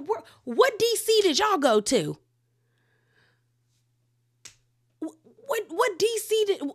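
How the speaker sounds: high, loud disbelieving cry; incredulous. Retold or imitated. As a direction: throw the question up in total disbelief. Incredulous at the show's lineup.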